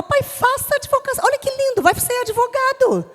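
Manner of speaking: put-on a child buttering up her father; coaxing child. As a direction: sweet-talk dad into agreeing.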